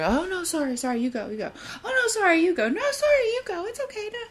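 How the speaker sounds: high, breathy-fast apologetic back-and-forth with little laughs; over-apologetic banter. Retold or imitated. At the coffee shop sugar, a people-pleaser apologizing over and over. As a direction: play the flustered person who keeps saying sorry.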